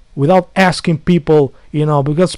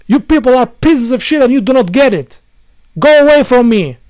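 angry shout; 6 semitones higher, 6 dB louder than usual. Real, not acted. Ranting at commenters.

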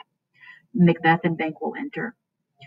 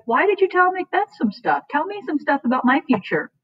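high-pitched, pleading paraphrase; playful high Banquo voice; mock eager Banquo; 10 semitones higher than usual. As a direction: squeak out the demand like an impatient kid. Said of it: "Banquo's like".

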